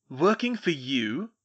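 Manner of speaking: slow, loud incredulous question; demanding. A narrator's reading. Malfoy challenging Hermione over his servant, slow loud demand. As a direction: demand it slowly, word by word. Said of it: "Mister Malfoy demanded".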